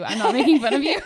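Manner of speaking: apologizing while laughing; giggly apology. Real, not acted. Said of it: "doesn't fit with [name]'s personality".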